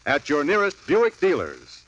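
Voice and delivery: ordinary delivery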